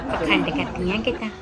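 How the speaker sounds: hushed aside. Real, not acted. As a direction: whisper it behind their backs. Gossiping aside at funeral.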